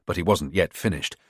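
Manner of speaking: plain reading voice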